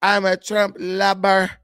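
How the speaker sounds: mock Mexican accent, "lover" said as "lober" with a B, slow. Shown as a demonstration. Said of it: "change the V to a B like Mexican".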